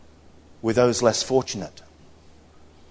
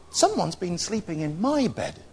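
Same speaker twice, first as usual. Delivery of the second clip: soft, higher mother bear voice; gentle Mother Bear; 6 semitones higher, 4 dB quieter than usual. Acted. Mother Bear sees bedclothes turned back, soft high voice. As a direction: say it lightly and softly as Mother Bear.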